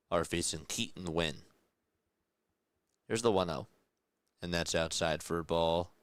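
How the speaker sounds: live baseball announcer calling the pitch; play-by-play call. Acted. Streamer calling the game for chat, in announcer voice. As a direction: call it like a broadcaster in the booth.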